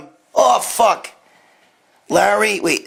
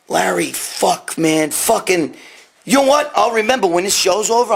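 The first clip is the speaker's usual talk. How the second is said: ordinary delivery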